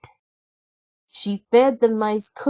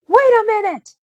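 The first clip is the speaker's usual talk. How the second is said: high, loud call to stop her; 8 dB louder, faster than usual. A narrator's reading. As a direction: shout her back from the door. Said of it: "called Drizella".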